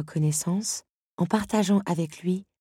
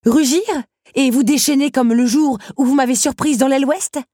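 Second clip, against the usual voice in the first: much higher, much louder taunting; defiant challenge; 11 semitones higher, 10 dB louder than usual. Acted. Belle taunts the beast who rose in anger, furious. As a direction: throw it in his face.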